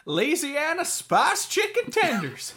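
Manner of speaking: slow, loud, high cowboy drawl; old Western accent. Acted. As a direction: read the menu like an old cowboy. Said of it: "makes you talk like an old Western dude".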